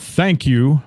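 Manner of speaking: ordinary talk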